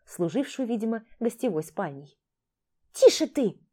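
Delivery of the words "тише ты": sharp, high shush; shushing hiss. Acted. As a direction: hush him sharply. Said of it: "шикнула на него Яшма".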